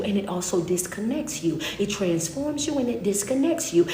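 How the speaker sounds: ordinary talk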